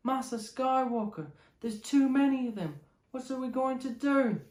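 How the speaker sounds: put-on high, pleading little kid; scared child voice. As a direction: voice a frightened youngling looking up. Frightened youngling voiced to Anakin.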